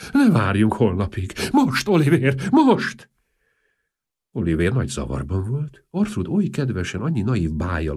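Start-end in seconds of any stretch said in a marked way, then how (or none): 0.1-3.1 insistent, louder, jumping high on the last word; urgent pleading. A narrator's reading. Princess urging Oliver not to wait till tomorrow, urgent. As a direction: press him urgently, peaking on a high, loud cry.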